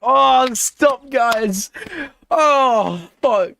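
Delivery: high touched cry; overwhelmed squeal. Real, not acted. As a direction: squeal at the emotional scene. Teary podium moment.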